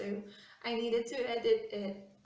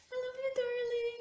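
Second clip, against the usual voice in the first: high sweet; 12 semitones higher than usual. Real, not acted. Tender message, repeated much higher.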